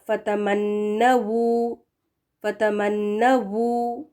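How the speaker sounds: فتمنو stretched slowly, rounded final wu; slow model word. Shown as a demonstration. Teacher models فتمنو slowly with rounded lips. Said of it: "ہونٹوں کو گول رکھیں".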